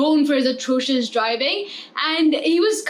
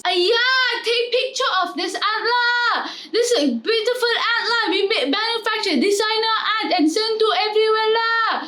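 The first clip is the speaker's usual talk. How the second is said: mock Chinese accent, pitched higher; higher Chinese-accented sales pitch; 5 semitones higher than usual. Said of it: "Then we have the Chinese".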